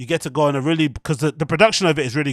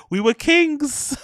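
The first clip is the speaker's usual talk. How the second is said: mock proud proclamation; 10 semitones higher, much slower than usual.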